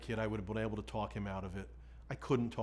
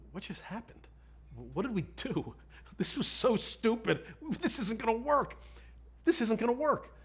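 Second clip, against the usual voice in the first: much higher, half-laughing panic; reliving the moment it all went wrong, voice climbing; panicked disbelief; 11 semitones higher than usual. Retold or imitated. Alone with John after debriefing.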